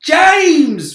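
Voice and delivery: high, loud desperate call; bawling centipede. A narrator's reading. Centipede bawls for James to save him, high loud plea. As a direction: bawl for help. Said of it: "bawled the centipede".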